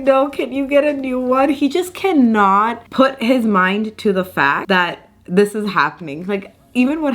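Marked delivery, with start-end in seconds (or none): 0.0-1.5 mock dad protest. Vlogger imitating her dad's protest at the sweets, mock higher voice. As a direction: play dad balking at feeding his daughter.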